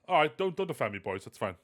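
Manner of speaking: ordinary delivery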